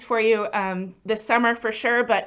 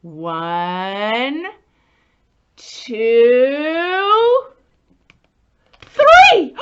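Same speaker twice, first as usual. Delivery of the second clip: building count to a laughing shout; 3 semitones higher, 10 dB louder, much slower than usual. Acted. Teacher counting to find the mouse, rising to a laughing shout. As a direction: count up, ending in a high, laughing, shouted "three".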